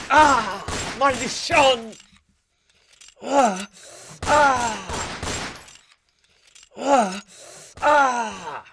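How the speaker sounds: high, loud wounded cries; agonised, high-pitched yelling; howling in pain. A narrator's reading. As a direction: howl and curse from a gunshot wound. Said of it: "Aulló Cholmes".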